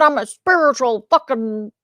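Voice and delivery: lightly voiced arrogant guru; mocking fake teacher.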